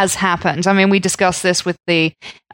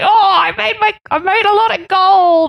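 gold miner voice; 11 semitones higher than usual. Pretend. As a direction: brag like a freshly rich gold miner. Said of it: "Did you like my gold miner voice".

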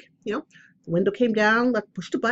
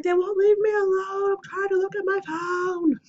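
put-on high exasperated yell of "won't leave", repeated to the kid; mock scream; 11 semitones higher than usual. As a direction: act out yelling at the spirits as a mild exasperated shout. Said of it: "I'm screaming at my phone".

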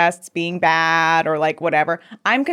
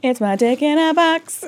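ordinary talk

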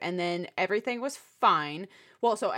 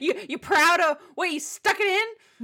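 higher, louder sarcastic jab; mock taunting; 9 semitones higher, 6 dB louder than usual. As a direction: sneer it like a jealous lover. Mocking the cheating lover.

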